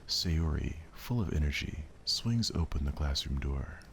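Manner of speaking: measured narrator voice; story narration. A narrator's reading. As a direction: set the scene calmly. Narration of visual novel.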